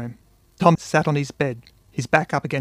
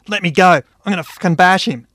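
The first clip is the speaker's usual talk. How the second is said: higher, much louder angry yell; furious snarl; 5 semitones higher, 8 dB louder than usual. A narrator's reading. Tom fighting to reach Buddy, furious shout. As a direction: roar it while being held back. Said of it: "he snarled".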